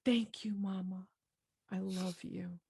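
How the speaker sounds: put-on high, soft toddler voice; little girl. Little girl to her mother after being comforted, tender childlike. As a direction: imitate a small child's tender thanks. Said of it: "then the little girl says".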